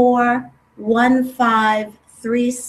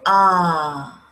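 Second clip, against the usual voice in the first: exhaled ah; 6 semitones lower than usual. Shown as a demonstration. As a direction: let the breath go on ah. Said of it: "Exhale, saying".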